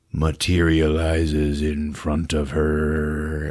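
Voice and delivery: slow, low suspenseful narration. Acted. Recapping the ghost appearing, slow suspense. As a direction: draw it out like a horror trailer. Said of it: "slow suspense narration".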